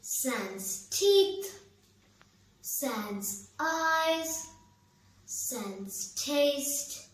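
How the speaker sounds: grand swell sinking into slow, spaced-out, fading phrases; solemn theatrical ending to a verse monologue; poem recitation. Acted. Recites closing lines.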